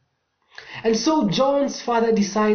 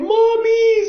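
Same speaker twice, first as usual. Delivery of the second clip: high loud frightened cry; crying for mommy; 6 dB louder than usual. A narrator's reading. Scared rider crying out for mommy. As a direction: wail for your mom. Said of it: "Some were crying".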